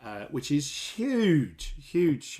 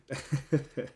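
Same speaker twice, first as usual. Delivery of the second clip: pleased laugh; 3 dB quieter than usual. Real, not acted. Laughs at own prediction.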